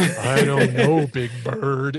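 slow, drawn-out creepy mock Snuffy; mock the creepy voice, slowly; creepy Snuffy mimic. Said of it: "It's like, ugh".

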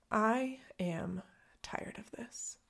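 fading into a tired whisper; voiceless, drained admission; weary whisper. Retold or imitated. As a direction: let it drop away to a whisper, worn out. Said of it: "list of things that my brain thought".

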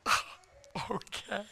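faint, high, soft reply; barely manage the words, small and airy; imitating a frail patient; weak trach voice. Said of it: "had a whisper 'cause of the trach".

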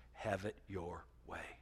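ominous verdict. Acted. God's dreadful verdict.

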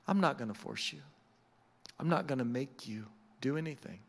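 soft, low, unforceful voice; gentle reassurance. Acted. Jesus leaving the choice to the listener, gentle reassurance.

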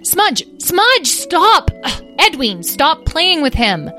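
high, loud, fed-up shouts; angry golden dragon losing patience; exasperated scolding. Acted. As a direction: yell at both brothers to quit. Sister scolds squabbling siblings.

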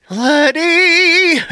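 sudden high squeaky blurt; blurted squeal. Acted. Embarrassed man blurts out word.

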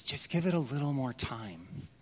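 soft, low, patient plea; a tender gardener asking for mercy; gentle gardener. Retold or imitated. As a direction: coax it quietly, like pleading for time. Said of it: "He says".

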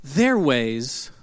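soft, slow gentle correction; sweet, loving wife. Retold or imitated. Wife gently teasing husband after thirty minutes of hating. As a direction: tenderly set him straight. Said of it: "in her sweet, loving way".

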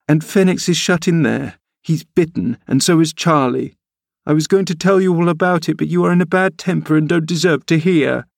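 put-on a child teasing her cross nurse; sulky young girl. A narrator's reading. Girl Cathy sulks at nurse.

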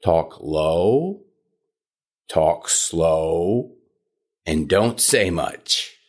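low, slow, few words. Shown as a demonstration. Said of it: "What you do is this".

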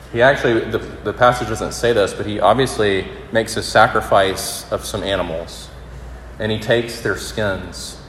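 normal talking voice

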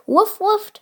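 high-pitched playful woofs; puppy's bark. A narrator's reading. Puppy answering Dora about the surprise, high barking. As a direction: bark the words like an eager pup. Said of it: "barked Piero".

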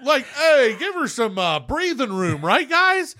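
high, nervous backing-off voice; high uneasy peacemaker; timid bystander. Retold or imitated. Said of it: "Maybe I should take a step back".

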